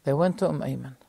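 usual speaking voice

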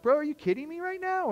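high, incredulous cry; can't believe the check; retold amazed exclamation; stunned disbelief.

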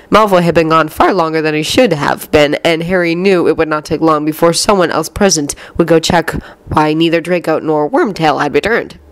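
usual speaking voice

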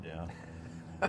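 a breathless, sheepish whisper; barely voiced admission; whispering guiltily; whispered yeah. Caught dipping, sheepish reply.

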